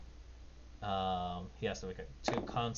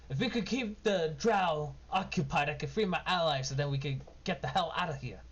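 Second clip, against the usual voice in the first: higher, louder halfling plea; urgent rogue asking for time to free his friends; [name]'s voice; 6 semitones higher, 3 dB louder than usual. Acted. Halfling rogue tells the party to hold the drow, urgent higher voice. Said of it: "[name] says".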